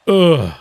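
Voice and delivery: loud groan falling from high pitch. Real, not acted. Groan at bad game moment.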